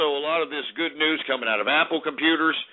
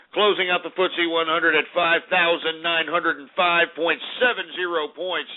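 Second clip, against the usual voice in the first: ordinary delivery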